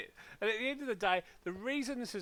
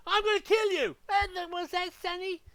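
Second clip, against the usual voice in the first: mock shouted threat; 9 semitones higher, 6 dB louder than usual.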